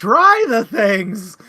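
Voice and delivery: loud, high, slow exasperated shout; exasperated yell. Retold or imitated. Book club reviewer yelling at a character, exasperated shout. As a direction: yell at her to just try the things.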